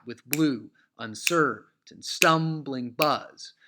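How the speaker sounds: exaggerated scansion reading of "stumbling buzz"; emphatic iambic stress. Shown as a demonstration. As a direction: hammer every second syllable, "unCERtain, STUMbling BUZZ". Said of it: "don't read it that emphatically".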